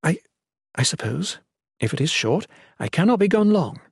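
halting, reluctant agreement; tentative consent with pauses; unsure. A narrator's reading. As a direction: hesitate between phrases, rising in pitch at the end. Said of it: "voice unsure".

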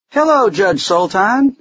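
high, thin voice, slow and careful; slow creature greeting. A narrator's reading. Fuzzy greets the judge, slowly in a high voice. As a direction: sound out each word slowly, pitched high. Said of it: "said the Fuzzy slowly".

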